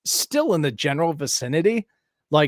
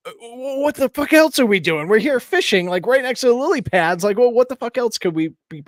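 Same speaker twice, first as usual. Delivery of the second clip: high, loud, incredulous venting; retelling his outburst at the dog owner, exasperated; exasperated rant; 10 semitones higher, 4 dB louder than usual. Retold or imitated. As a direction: let the disbelief boil over. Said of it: "I'm like".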